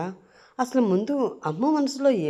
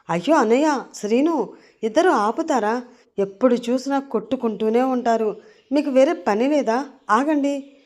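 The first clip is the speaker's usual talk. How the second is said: raised, higher voice, scolding and urging; exasperated protest; 6 semitones higher, 4 dB louder than usual. Acted. Sister separating her fighting brother and his friend, raised exasperated voice. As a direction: call out to break it up, exasperated.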